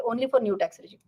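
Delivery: normal talking voice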